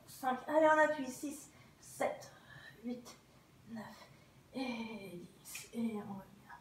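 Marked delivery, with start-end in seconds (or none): 1.1-5.7 breathy strained count. Real, not acted. Counting while holding the exercise. 5.7-6.5 whispered. Real, not acted. Release after the hold.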